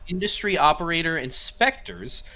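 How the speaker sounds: steady read-aloud of a news report. Acted. Co-host reads a leaked ATF story to the stream, newsy read. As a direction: read the article out flat and clear.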